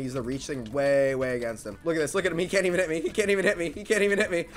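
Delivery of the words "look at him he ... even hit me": ordinary delivery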